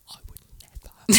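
mocking whisper, imitating her; whispered quote. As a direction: hiss it back in a mock whisper. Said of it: "Hang on, let me do it".

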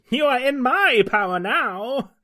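slow, high, loud villain sneer; gloating evil witch taunt; evil cleric. Acted. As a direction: cackle it out like a wicked witch. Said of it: "The evil cleric laughs".

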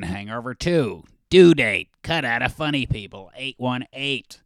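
lightly voiced hyped comic, bursts of loud cheers; a hint of a hyper motivational shouter; Brody Stephen impression. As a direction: pump up the room like a hyped-up comic. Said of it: "it always reminds me of Brody Stephen".

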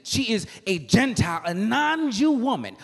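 high, loud sung preaching cadence; whooping preaching. Acted. Preacher whooping the Canaanite woman's plea to the church. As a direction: tune it out to the congregation like a whooping preacher.

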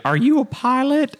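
high, slow mock question; high mock voice of a Dollywood stranger asking; quoting a stranger. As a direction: imitate her puzzled voice.

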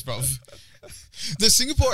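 usual speaking voice

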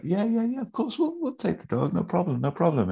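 high-pitched, obliging imitation; breezy agreement. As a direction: brush it off in a put-on high voice. Voices Michael agreeing to help.

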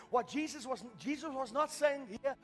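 ordinary delivery

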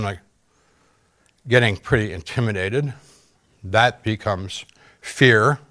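ordinary delivery

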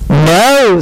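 loud, drawn-out objection; firm objection. A narrator's reading. Star disputing what the fish said, firm objection. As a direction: cut in with a firm no.

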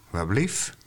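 normal talking voice

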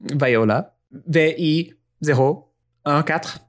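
put-on high French-accented introduction. Viola introducing herself to Cincinnati in a French accent. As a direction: spell the name out in French.

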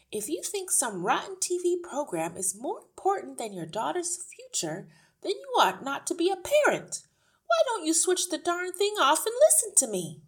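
indignant scolding, rising high and loud; losing patience. A narrator's reading. Miss Honey scolds a father glued to TV, losing patience. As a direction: tell him off with mounting force. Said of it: "began to lose patience".

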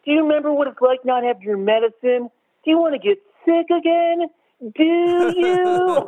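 high-pitched hammed-up bad acting; bad actor. Host mocking the older brother's medicine scene, hammy high-pitched bad acting. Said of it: "it's so bad, and he goes".

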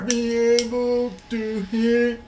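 high, slow deaf person voice; slow, high imitation of a deaf speaker. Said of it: "I'm trying to sound like a deaf person now".

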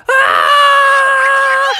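very high, sustained cartoon scream; falling scream. Smee plummeting toward the river, high scream. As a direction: scream all the way down.

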